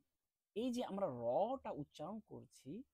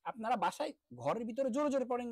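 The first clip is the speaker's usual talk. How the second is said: louder, insistent instruction; firm urging; 5 semitones higher, 7 dB louder than usual. Real, not acted. Teacher urging students to read aloud, louder. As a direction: push them to read out loud. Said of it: "urging students to read".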